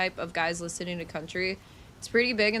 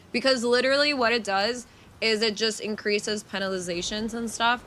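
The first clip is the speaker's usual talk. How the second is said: ordinary talk, nothing marked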